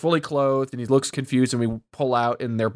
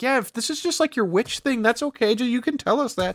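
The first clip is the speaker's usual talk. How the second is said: higher, mock-gentle coaxing voice; Jeff's careful voice; 9 semitones higher than usual. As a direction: play Jeff tiptoeing around her, pitched up. Jeff voiced gently in recap.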